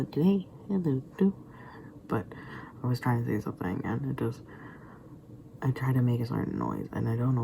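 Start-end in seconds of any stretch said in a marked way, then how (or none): 0.0-1.3 voice cracking. Shown as a demonstration. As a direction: voice cracks up high on each word. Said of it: "when I try to speak certain ways, they go".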